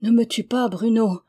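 ordinary delivery, nothing marked

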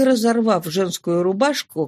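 ordinary delivery

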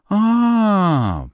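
very high, long falling "ah"; drawn out. A narrator's reading. Woman slowly recognising hero, drawn-out high realisation. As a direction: stretch the sound out loudly. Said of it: "протянула она".

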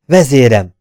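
loud agitated call to his leader; agitated cry. Acted. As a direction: burst out in alarm to the leader. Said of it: "mondta a báró felindultan". (felindult, hangos kiáltás)

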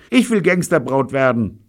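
high, loud cry; shouting. A narrator's reading. Woman begging to become a gangster's bride, shouting. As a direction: call out the wish in a high voice. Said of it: "schrie Evelyn".

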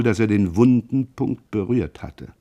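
plain reading voice

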